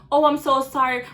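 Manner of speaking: put-on, insincere-sounding apology. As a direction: mimic her hollow sorry. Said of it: "way how she said it was so fucking insincere".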